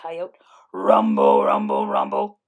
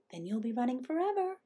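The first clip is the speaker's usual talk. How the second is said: higher, soft little voice; tiny Hummingbird; 4 semitones higher, 5 dB quieter, faster than usual. A narrator's reading. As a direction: answer small and quietly as a bird. Tiny Hummingbird answers Coyote.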